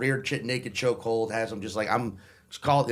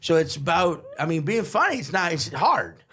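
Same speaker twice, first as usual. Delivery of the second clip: higher, louder mock-drunk rambling; drunk act; 5 semitones higher, 3 dB louder than usual. Drunk journalist struggling to ask about being funny, mock drunk. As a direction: stumble through it like a drunk interviewer. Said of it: "drunk but trying to also".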